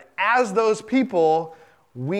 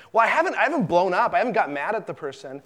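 defensive self-justification; 3 semitones higher, faster than usual. Retold or imitated. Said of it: "this simmering anger".

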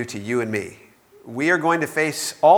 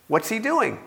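ordinary talk

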